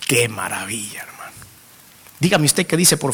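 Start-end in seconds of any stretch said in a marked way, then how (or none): 0.1-1.3 breathless hushed wonder; a soft reverent whisper of amazement; awed whisper. Real, not acted. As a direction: whisper it in awe. Awed aside to congregation.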